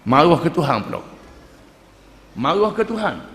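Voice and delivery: ordinary delivery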